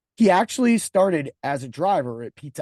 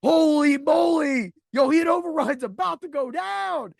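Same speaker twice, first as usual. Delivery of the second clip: loud slow hype, then higher; excited class; 9 semitones higher, 6 dB louder, slower than usual. Retold or imitated. Whole class hyping up a fight, loud excited. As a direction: egg on the fight.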